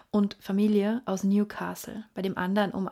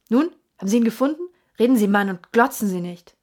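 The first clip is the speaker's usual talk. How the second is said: loud, rising rant, faster at the end; furious; 7 dB louder than usual. A narrator's reading. Sir Henry shouting at the hotel waiter, furious. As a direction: demand answers, shouting. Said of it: "Er war dermaßen wütend".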